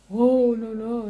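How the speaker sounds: loud, put-on monster groan; hammed-up big monster voice. Shown as a demonstration. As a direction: boom it out like a storybook monster. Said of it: "Se é um personagem de monstro".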